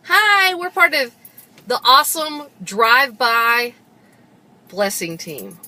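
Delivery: high, loud team intro; show-opening shout, easing down at the end; announcer greeting. Pretend. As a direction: belt out a big, drawn-out hello to the audience. Upbeat team introduction to camera.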